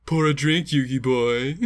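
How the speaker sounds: overacted anime dub villain; Pegasus dub voice. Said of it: "Just for the dub voice".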